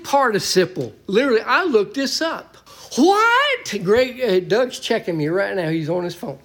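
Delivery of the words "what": What yelled very high; loud preacher's What; emphatic shout. Shown as a demonstration. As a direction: cry it out like it's impossible. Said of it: "emphatically say it in the Greek text".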